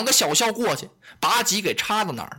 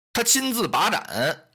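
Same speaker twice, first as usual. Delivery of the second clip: ordinary delivery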